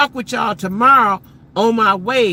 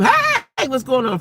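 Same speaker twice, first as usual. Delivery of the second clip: cheery greeting; 7 semitones higher, 3 dB louder, slower than usual. Retold or imitated. Greeting someone she was mad at.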